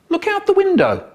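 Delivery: piping cartoon call; squeaky high voice.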